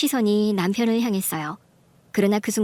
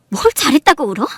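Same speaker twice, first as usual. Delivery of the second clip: raised, loud rebuke; angry scolding; 4 semitones higher, 7 dB louder than usual. Acted. Wife to her weeping husband, angry. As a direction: scold him for daring to cry.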